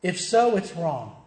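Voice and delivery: usual speaking voice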